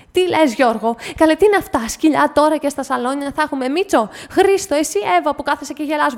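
high, loud exasperated tirade; αγανακτισμένη κατσάδα; indignant scolding. Acted. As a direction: berate the whole family. Lady scolding everyone.

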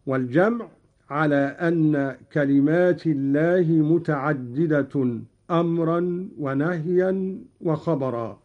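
ordinary delivery

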